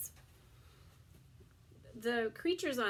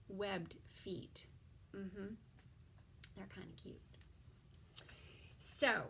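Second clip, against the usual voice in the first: ordinary talk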